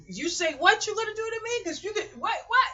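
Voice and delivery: high, loud confrontational questioning; angry challenge. Retold or imitated. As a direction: throw the threat back in their face.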